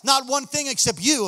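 loud, high preacher's cry; fiery preaching. Acted. Preacher rallying the church that nothing can stop them, full force. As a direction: build and hammer each line.

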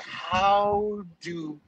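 high, disgusted retelling; disgusted wife. Retold or imitated. Wife quoted finding hairs, mock disgusted. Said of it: "She was like".

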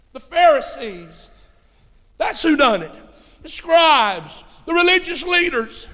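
high, punched pulpit indictment; emphatic preacher's call, stretched phrase endings; forceful preaching. Acted. Preaches against religious leaders.

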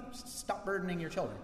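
ordinary delivery

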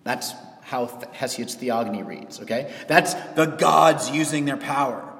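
ordinary delivery

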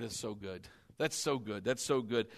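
low, hushed private murmur; soft, low-pitched savoring; inward praise. Retold or imitated. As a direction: murmur it to God, unnoticed. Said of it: "trying to not draw attention to myself".

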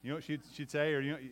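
ordinary delivery

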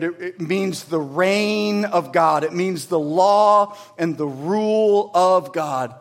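ordinary talk, nothing marked

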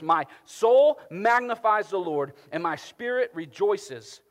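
loud, slow rejoicing; joyful proclamation. Retold or imitated. Preacher voices rejoicing Mary, loud and slow. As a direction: proclaim it with joy. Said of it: "singing at the top of her lungs".

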